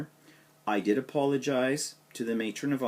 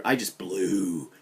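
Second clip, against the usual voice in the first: ordinary talk, nothing marked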